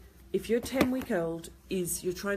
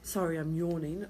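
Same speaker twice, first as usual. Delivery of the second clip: ordinary delivery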